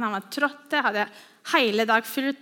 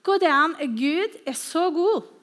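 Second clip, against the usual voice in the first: higher, slowing down in awe; amazed wonder; 5 semitones higher than usual. Retold or imitated. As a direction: believer stunned by an answered prayer, in awe. Marvelling at answered prayer.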